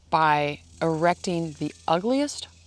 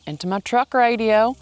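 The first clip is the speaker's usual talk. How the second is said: a louder, higher, hammed-up Southern twang; a deliberately bad Southern accent, louder and higher; terrible Southern accent; 6 semitones higher, 7 dB louder than usual. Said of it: "That's a terrible Southern accent".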